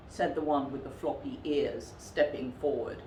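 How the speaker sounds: usual speaking voice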